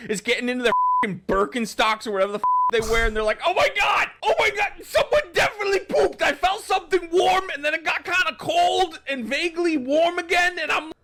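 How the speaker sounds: high, loud, frantic bit; yelly. Acted. Comic telling a poop bit, high and yelly. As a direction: yell it in high-pitched disgusted alarm. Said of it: "a little fixated and yelly".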